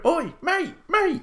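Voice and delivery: high calling shout across the street. Retold or imitated. Calling out to a band member in the street, high shout. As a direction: yell to a passing band member. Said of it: "just shout out the band name and go".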